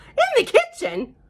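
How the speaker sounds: high, loud mock-shocked question; mock surprise. Storyteller to children, playfully shocked at the kitchen helicopter. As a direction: gasp out the question in playful disbelief.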